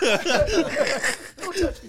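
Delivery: slow, loud laughing line; laughing mock recoil. Gangster backing away, voiced laughing. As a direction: draw it out through laughter.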